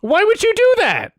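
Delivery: real exasperation, pitched high; exasperated anger. Real, not acted. Reactor challenging the video's maker, exasperated anger. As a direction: demand it in a high, angry voice. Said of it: "I'm so mad".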